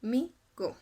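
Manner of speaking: "mi go" split into two beats, mi, go; two separate syllables. Shown as a demonstration. Teacher counting out remaining syllables, "mi go" in two beats. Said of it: "en otras dos".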